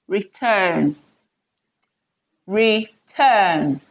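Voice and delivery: reTURN, slow, TURN drawn out and falling; stress on second syllable. Shown as a demonstration. Teacher giving example verb, TURN in return stressed. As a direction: keep re light, stretch TURN in return. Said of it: "the second syllable".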